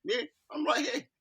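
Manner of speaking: pitched well up, mock proud; lifted, declaring herself present; confident announcement. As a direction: call out I'm right here, high and bright. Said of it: "confidence to say".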